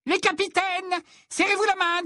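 very high, shrieked referee orders; piercing, commanding referee voice; referee calling out. A narrator's reading. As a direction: call the captains together in a high voice. Referee calls out to captains.

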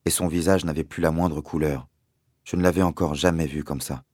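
ordinary delivery, nothing marked

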